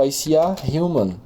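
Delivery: normal talking voice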